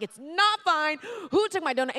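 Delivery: high, loud retold outburst; yelling. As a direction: yell back in comic indignation. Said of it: "I am yelling at my friends".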